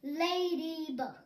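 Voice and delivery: ladybug drawn out slowly, every syllable clear. Shown as a demonstration. Teacher modelling a new word for kids, slow and clear. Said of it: "Các bạn nói nhanh hơn nhé".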